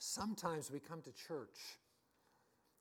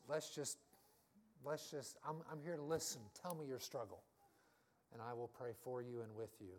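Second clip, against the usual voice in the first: gentle reassurance; 4 semitones lower, 5 dB quieter than usual. Retold or imitated. Voicing supportive fellow believer.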